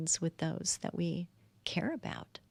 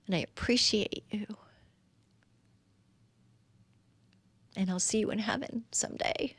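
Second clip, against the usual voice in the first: tender whisper. Acted. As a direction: breathe it like a last goodbye. Tender farewell to loved ones.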